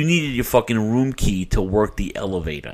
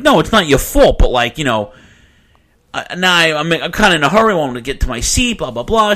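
raised, louder insistent voice; retold agitation; 6 semitones higher, 6 dB louder than usual. Fan in a hurry to attendant, agitated insistence. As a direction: re-enact hurried, worked-up reassurance.

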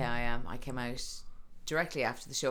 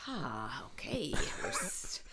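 tired whisper; 6 dB quieter, much slower than usual. Retold or imitated. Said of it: "I'm kinda like".

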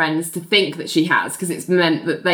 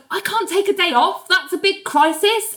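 high Ali voice; 10 semitones higher than usual. Retold or imitated. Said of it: "And Ali's like".

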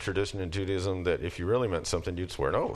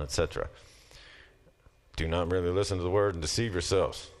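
normal talking voice

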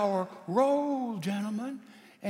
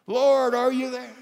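calling in prayer; 5 semitones higher, 7 dB louder than usual. Acted. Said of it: "He prayed and nothing".

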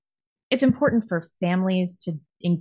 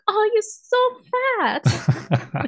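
mock Japanese lady; slower than usual. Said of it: "some Japanese lady like".

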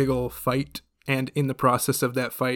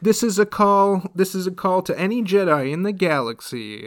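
radio call; 6 semitones higher, 4 dB louder than usual. Retold or imitated. Robot making a radio call.

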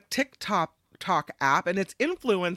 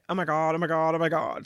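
put-on valley girl voice; 3 semitones lower, faster than usual. Quoting young women's uptalk.

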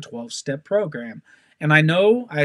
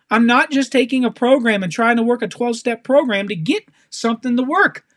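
normal talking voice

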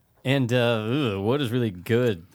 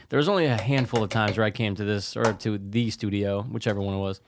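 ordinary talk

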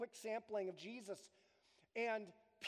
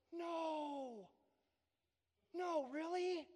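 innocent surprise; 5 semitones higher, much slower than usual. Retold or imitated. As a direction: sound truly taken aback, gently. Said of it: "a heart that is innocent and goes".

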